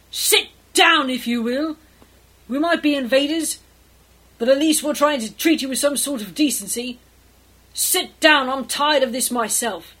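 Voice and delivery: loud, indignant tirade; fed up, lay into them with forceful emphasis; snapping. Acted. Edmund snaps at the captain to everyone's surprise, angry outburst. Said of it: "Edmund snaps".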